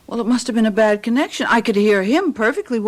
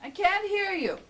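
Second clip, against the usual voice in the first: softer, distant reply from off; from another room; 3 semitones higher, 10 dB quieter than usual. Acted. Wife calling back from the bedroom, distant and softer. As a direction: answer from far away.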